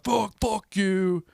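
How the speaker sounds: high, loud, drawn-out curse; mock drunken scream; retold screaming. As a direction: re-enact yelling an insult at someone. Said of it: "screaming at a, a lady".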